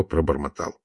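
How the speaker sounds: ordinary delivery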